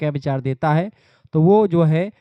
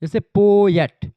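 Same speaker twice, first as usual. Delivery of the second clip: "Poet" with पो punched higher and louder, slowly; stress on PO; 4 semitones higher, 7 dB louder, much slower than usual. Shown as a demonstration. Teacher shows students the stress on पो in "poet". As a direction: lean hard on पो in "poet", keep एट light. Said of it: "poet बोलने में पो पर ज़्यादा focus करते हैं".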